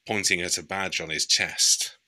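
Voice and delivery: plain reading voice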